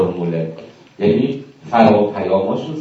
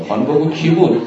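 impatient husband; 5 semitones higher, 5 dB louder than usual. Retold or imitated. Impatient husband demands answer.

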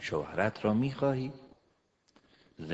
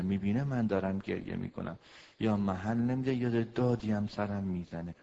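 ordinary talk, nothing marked

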